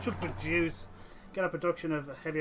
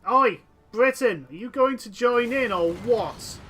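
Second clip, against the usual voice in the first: loud shout at the Britain AI; calling out; 5 semitones higher, 9 dB louder than usual. Real, not acted. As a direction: yell across at an ally who won't join.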